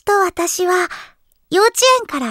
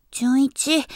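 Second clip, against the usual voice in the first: ordinary talk, nothing marked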